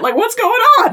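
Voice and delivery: high-pitched, baffled exclamation; incredulous outcry. Acted. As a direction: cry out in mock disbelief, pitch shooting up. Reacting to neighbors ignoring screams.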